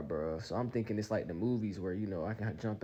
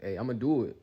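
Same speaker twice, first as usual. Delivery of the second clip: louder retold warning; quick warning; 3 semitones higher, 8 dB louder than usual. To girlfriend before jumping, quick warning. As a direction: call out a heads-up, louder. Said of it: "just as a quick warning".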